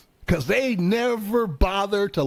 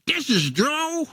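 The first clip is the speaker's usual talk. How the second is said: high, whiny radio call to dispatch; tow driver on radio; 9 semitones higher than usual. Acted. Tow driver griping to dispatch about a monster truck, high and whiny. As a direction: complain to dispatch like a stranded mechanic.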